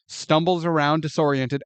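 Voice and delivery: normal talking voice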